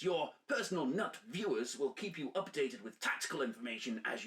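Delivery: game master scene setting; brief the spies like a handler; mission briefing. Acted.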